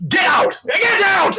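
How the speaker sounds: high, loud scream to drive him off. Acted. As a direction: shriek get out at the intruder. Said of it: "he just screams at him".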